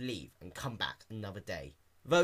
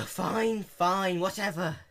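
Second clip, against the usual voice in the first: higher, exasperated giving-up grumble; groaning; 8 semitones higher, 6 dB louder, much slower than usual. A narrator's reading. Dash giving in to the clerk, exasperated groan. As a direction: give in with a groan. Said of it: "Dash groaned".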